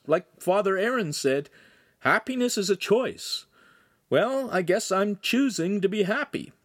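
put-on high-pitched earnest teen; Lincoln, resigned teen. A narrator's reading. Lincoln to Handsome about choosing happiness, resigned and high. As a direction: explain earnestly, pitched well up.

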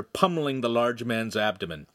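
ordinary delivery, nothing marked

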